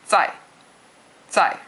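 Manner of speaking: zai sharp and falling, like a short yell; fourth tone zai. Shown as a demonstration. Teacher demonstrates the fourth tone like a short mild yell. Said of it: "sounds like you're yelling at somebody really short".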